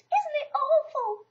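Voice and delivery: high, slow frightened squeak; scared Piglet. Acted. Piglet holding Christopher Robin's hand, trembling. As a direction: shrink back and squeak in dread.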